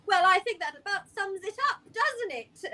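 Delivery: high, quick pleased tone; smug summary. Acted. Greta cheerfully wrapping up the scene, high and quick. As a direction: wrap it up brightly. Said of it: "Greta kinda goes".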